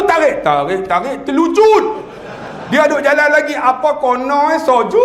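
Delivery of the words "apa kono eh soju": Negeri Sembilan dialect. Retold or imitated. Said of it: "tu qiraat orang Negeri Sembilan".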